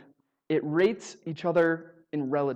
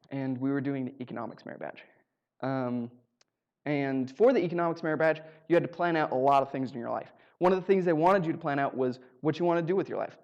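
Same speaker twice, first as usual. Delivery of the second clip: ordinary talk, nothing marked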